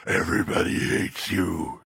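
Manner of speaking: low, slow, eerie taunt; a phantom voice whispering doom, lightly done; low creepy mock ghost; spooky voice. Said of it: "that voice that said".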